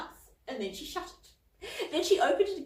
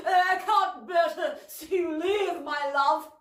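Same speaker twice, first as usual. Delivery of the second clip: mock tender; 7 semitones higher, 6 dB louder, slower than usual. Cauldron fake-wooing Polwarth.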